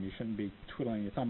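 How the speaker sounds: usual speaking voice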